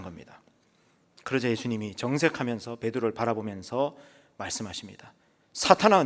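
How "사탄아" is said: a sudden high, loud cry of Satan; sharp rebuke. Acted. Jesus rebuking Peter, sharp and loud. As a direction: snap out the name like a blow.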